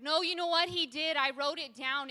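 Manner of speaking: firm, emphatic rebuttal; defiant retort. Acted. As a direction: push back hard at an accuser. Defiant retort to the enemy's accusation.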